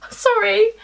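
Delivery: a laugh that cracks into tears; laughing through sudden crying, breaking off; real tearful laugh. Real, not acted. Caught off guard by her own tears, laugh-crying. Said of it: "Why are you crying".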